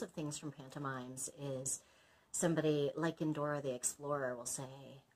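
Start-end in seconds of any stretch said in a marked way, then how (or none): none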